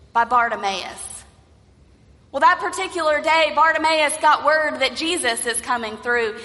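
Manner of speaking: ordinary talk, nothing marked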